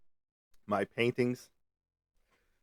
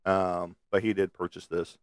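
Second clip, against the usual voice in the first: ordinary talk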